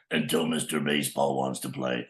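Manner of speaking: very low cartoon toy voice; toy character voice. Toy answering the boy in play, deep character voice. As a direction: drop right down for a second toy. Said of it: "So I couldn't do those voices".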